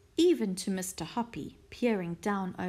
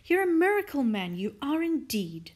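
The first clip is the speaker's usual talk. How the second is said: high, loud burst of praise easing off; admiring cry; 6 semitones higher, 4 dB louder than usual. A narrator's reading. Missus Silver praising Mister Hoppy, crying out admiringly. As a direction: exclaim in wonder, then settle. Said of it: "Missus Silver cried".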